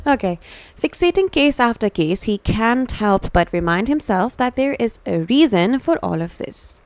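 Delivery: ordinary talk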